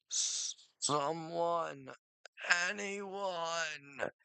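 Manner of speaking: high, breathless pleading, gasping for air; desperate plea for help. Acted. Chris alone after a nightmare, desperate high plea. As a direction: beg for help like you're suffocating.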